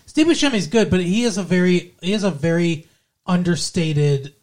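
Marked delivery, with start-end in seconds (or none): none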